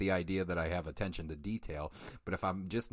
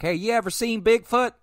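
put-on country accent; 12 dB louder than usual. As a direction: yell it like a Southern country boy. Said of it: "Reeves isn't super country".

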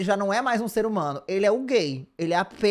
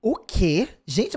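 ordinary talk, nothing marked

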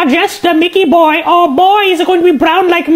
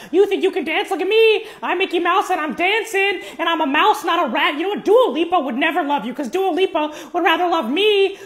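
quiet threat; 2 semitones lower, 8 dB quieter than usual. Acted. Quiet threats to Duolingo bird.

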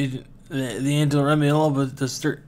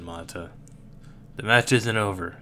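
normal talking voice

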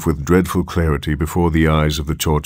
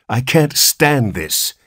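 slow, higher-pitched distraught plea; hoarse cry; 6 semitones higher, slower than usual. A narrator's reading. Anxious father who cannot stand the wait, crying hoarsely. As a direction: drag it out, hoarse and desperate. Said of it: "he cried hoarsely".

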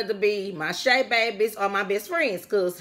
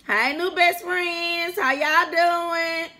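higher, slow drawn-out hello; welcoming greeting; 8 semitones higher, much slower than usual. Real, not acted. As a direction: stretch out a welcome to new friends. Welcoming new viewers.

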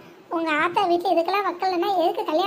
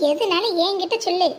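ordinary talk

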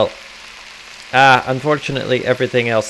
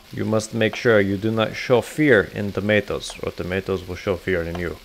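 ordinary talk, nothing marked

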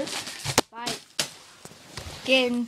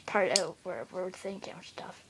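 whispered aside; whispering; 4 semitones lower, 6 dB quieter than usual. Real, not acted. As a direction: whisper it under your breath. Whispered aside about editing.

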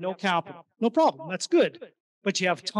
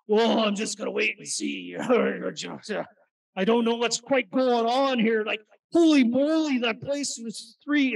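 mock-fretful, pitched-up skeptic voice, some lines slow; hesitant Edmontonian; 5 semitones higher, slower than usual. Imitating a jaded Edmontonian buyer who frets over prices. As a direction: ham up a jaded local balking at prices. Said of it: "That's Edmontonians that talk like that".

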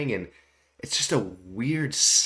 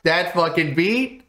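excited hype; 6 semitones higher, 4 dB louder than usual. Real, not acted. Hyped reacting to new song.

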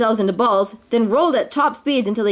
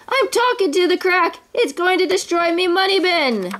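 high-pitched exasperated rant; worked-up storybook miser; heated; 8 semitones higher than usual. A narrator's reading. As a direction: wail it hotly, pitch held high. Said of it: "said Scrooge hotly".

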